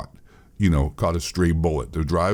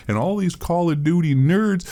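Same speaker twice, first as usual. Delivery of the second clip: ordinary talk, nothing marked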